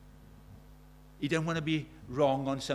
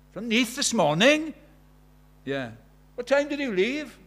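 higher, louder astonished head teacher; a headmaster amazed at the early drive; mock-incredulous head; surprised headmaster; 8 semitones higher, 9 dB louder than usual.

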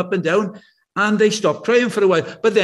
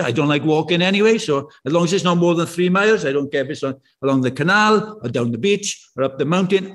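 ordinary talk

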